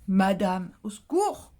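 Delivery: a hammed-up cry for help, pitch jumping up; mock horror. To her viewers, mock horror at being called madame. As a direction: pretend to be appalled by the word.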